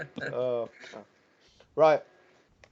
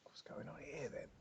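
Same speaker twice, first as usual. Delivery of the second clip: whisper; 23 dB quieter than usual. Real, not acted. Quiet aside while others draw.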